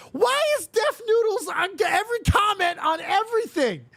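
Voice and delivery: real incredulous outburst; exasperated rant. Real, not acted. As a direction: rant at the guy who is everywhere. Exasperated rant about Deaf Noodles.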